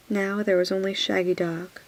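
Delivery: usual speaking voice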